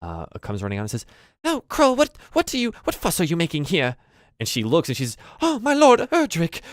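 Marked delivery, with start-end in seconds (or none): 1.4-4.0 put-on high-pitched, loud old woman; fussing old goblin maid. Maid runs out at the noise, fussing loudly. 5.4-6.6 high, loud exclamation; startled old maid. Acted. Maid spots her lord come home, startled.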